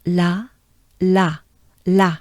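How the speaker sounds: "La" with an open French A; short, separate repeats of "La", each a French ah. Shown as a demonstration. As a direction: model the French A in "La". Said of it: "So the French A".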